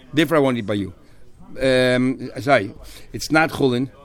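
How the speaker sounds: usual speaking voice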